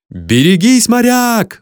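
loud, high warning shout; roar. A narrator's reading. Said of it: "взревели тут зрители". (громкий предостерегающий рёв)

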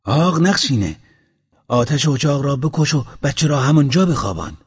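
loud instructions from a husband outside; loud calling out. A narrator's reading. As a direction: call across the yard to your wife. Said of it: "با صدای بلند گفت".

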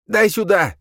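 high, loud snatching cry; snatch the gun with a yell; grabbing shout. A narrator's reading. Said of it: "выхватил". (резкий крик)

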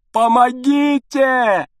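high, slow, full-voiced shout for help; desperate scream. A narrator's reading. Father screams for help to the doctor, full shout. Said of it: "а потом крикнул".

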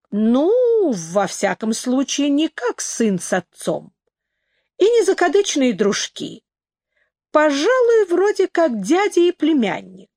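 readily chatty, pitch often lifting high; willing, forthcoming. A narrator's reading. Don explains Pietro's ties to the owner, willingly. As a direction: answer freely, weighing each comparison. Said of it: "Дон охотно ответил".

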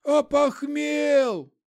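high, very drawn-out zombie moan; zombie groan. A narrator's reading. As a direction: drag out the word like undead. Zombie Petrovich voiced.

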